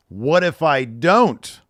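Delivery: indignant. Retold or imitated. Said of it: "Braun gets all indignant".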